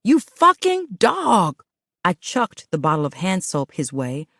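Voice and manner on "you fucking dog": high, loud, slow tearful yell. Acted. Woman yelling at her cheating man through tears. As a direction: hurl it at him through tears. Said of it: "as I yelled".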